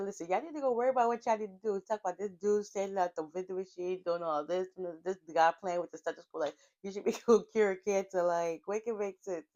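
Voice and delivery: high, fast, sassy rant at the room; sassy telling-off. Retold or imitated. Co-star snapping at onlookers, fast and high-pitched sassy. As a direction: tell the gawkers to mind their business, quick and high.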